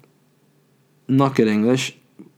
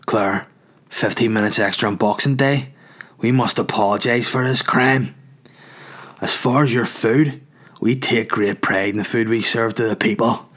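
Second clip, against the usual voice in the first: the owner's woman's voice. Retold or imitated. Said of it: "I'll do it in the woman's voice".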